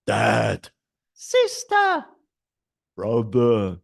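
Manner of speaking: teacher demo of varied intonation; varied voices. Shown as a demonstration. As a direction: play with pitch and loudness word by word. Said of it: "Use different intonation and level of voice".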